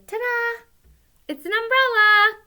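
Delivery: high, bright, showy reveal. Acted. Teacher voicing mom proudly showing her drawing. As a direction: announce it like a magic trick.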